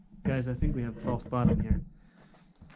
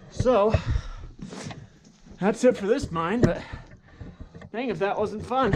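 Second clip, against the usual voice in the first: upbeat, higher and louder wrap-up; excited; 8 semitones higher, 5 dB louder than usual. Real, not acted. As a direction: finish with real enthusiasm. Wrapping up fun mine trip.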